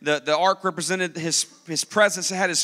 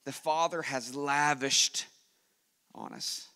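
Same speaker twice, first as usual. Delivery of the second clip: slow, softer, fading to a whisper; slow reverent reading; 3 semitones lower, 4 dB quieter, slower than usual. Acted. Preacher quoting First John to the congregation, slow and hushed. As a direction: savour each word and let it fade. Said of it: "He goes".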